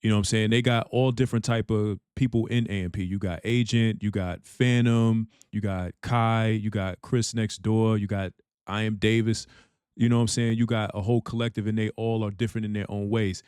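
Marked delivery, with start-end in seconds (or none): none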